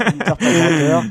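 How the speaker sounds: said through laughter, loud; laughing while repeating the joke. Real, not acted.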